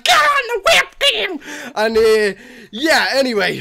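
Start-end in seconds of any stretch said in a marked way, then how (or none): 0.0-1.4 high, loud, stretched-out squeal; mock panicked yell, pitched way up; excited retold yell. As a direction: re-enact a frantic, overexcited shout. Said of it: "I was like".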